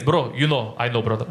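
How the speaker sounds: low-pitched knowing aside between brothers; imitated low-voiced bro acknowledgement; knowing bro talk. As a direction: drop your voice low, like you share a secret. Acts out Albanians after the show.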